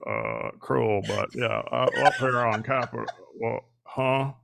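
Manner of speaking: put-on low, halting old senator voice; slow, confused, trailing off; Mitch McConnell. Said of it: "you also do Mitch McConnell".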